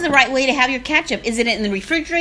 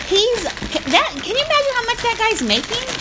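excited; 10 semitones higher than usual. Real, not acted. Amazed at the sauce mascot.

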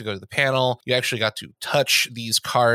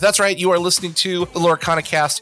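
booming showman welcome, pitched high; grand entrance announcement; 6 semitones higher, 3 dB louder than usual. Acted. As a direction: announce it like a game show host. Podcast host's big intro.

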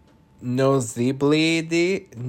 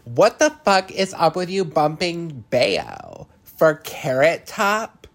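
storming out; 7 semitones higher, 4 dB louder than usual. Retold or imitated. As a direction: storm in and demand an answer. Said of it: "storming out".

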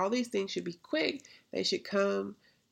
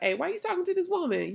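high, rapid challenge; acted incredulity; 10 semitones higher, much faster than usual. Disciples to Jesus at the well, incredulous. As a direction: question him in pitched-up disbelief. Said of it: "they was like".

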